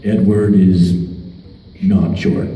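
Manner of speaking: a hint of a deadpan gruff Travis; mock deep compliment.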